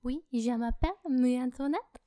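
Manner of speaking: hammed-up French girl voice; French accent. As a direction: put on a cartoon French accent as Marie Antoinette. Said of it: "do the Amélie voice but with a French accent".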